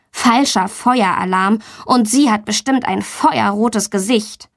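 plain reading voice

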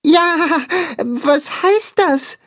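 high, slow, timid calf voice; high, hesitant calf voice; shy little calf. A narrator's reading. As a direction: ask it meekly like a small calf. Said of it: "fragt das schüchterne Kälbchen".

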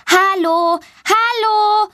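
high, loud hello; calling out loud. A narrator's reading. Girl calling to children's voices, high and loud. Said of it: "ruft sie laut".

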